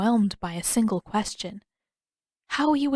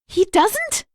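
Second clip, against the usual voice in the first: much higher, louder disbelieving question; voice creeping higher; 13 semitones higher, 6 dB louder than usual. Acted. Katara hearing Sokka doesn't know, voice rising high. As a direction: let the pitch climb in disbelief. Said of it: "She felt her voice creeping higher".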